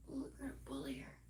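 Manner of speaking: sly scheming whisper, fast; mock bully whisper. Voicing bullies plotting against her.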